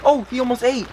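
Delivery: can't believe he almost ate; excited. Real, not acted. Owner thrilled his sick fish nearly ate, high excitement. Arowana finally shows appetite.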